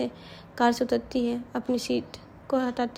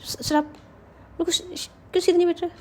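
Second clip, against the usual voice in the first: tipsy scolding; 5 semitones higher than usual. A narrator's reading. Drunk girl's slurred scolding.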